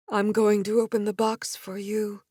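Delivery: flat, entranced monotone. A narrator's reading. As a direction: speak like a hypnotised puppet. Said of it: "in a monotone voice".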